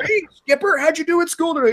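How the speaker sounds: a hint of a chirpy dad checking on his kid; mock sitcom dad. Imagined Nazi dad asks son.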